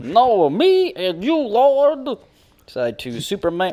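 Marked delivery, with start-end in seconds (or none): 0.0-2.2 much higher, louder hammed-up comic voice calling to the Lord. Reading [name] track titles, hammed-up high comic voice. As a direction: call out to the Lord in a goofy high voice. Quoting [name]'s Noah bit.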